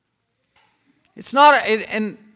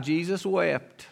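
hushed solemn; 6 semitones lower, 13 dB quieter than usual. Acted. Solemn verse after joking memorization.

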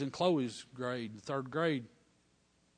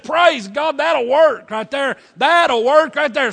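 rejoicing; 10 semitones higher, 16 dB louder, much slower than usual. Real, not acted. Preacher rejoices at the story.

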